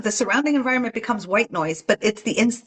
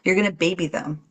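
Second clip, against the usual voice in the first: ordinary delivery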